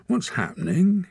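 very high, frightened pup voice; anxious wolf cub. A narrator's reading. Trapped wolf cub asks Torak what's happening, high frightened. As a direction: yelp it like a scared cub.

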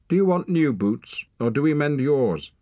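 high, loud irritated snap; a sharp, loud woman's snap over new boots; snapped. A narrator's reading. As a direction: bite off the question at him, annoyed. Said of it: "snapped Oslak's mate".

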